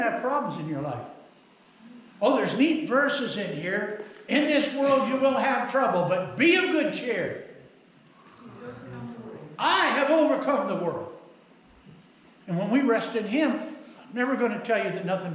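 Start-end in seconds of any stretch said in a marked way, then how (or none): 4.3-11.1 raised, ringing preacher's declaration; forceful Bible quotation from the pulpit; emphatic scripture quote. Acted. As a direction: proclaim the verse higher and a little louder.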